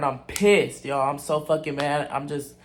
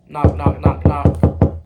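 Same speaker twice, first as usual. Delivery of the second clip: imitated knocking; 11 dB louder, much slower than usual.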